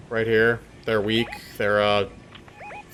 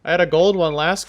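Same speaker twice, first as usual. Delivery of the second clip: excited; 10 semitones higher, 5 dB louder than usual. Real, not acted. Excited loot find.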